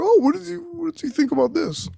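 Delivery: high, chirpy question; mock audience member. A listener asking what he thought, high chirpy mock question. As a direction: ask brightly like a confused listener. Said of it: "someone's probably just like".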